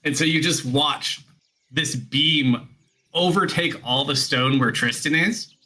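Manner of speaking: usual speaking voice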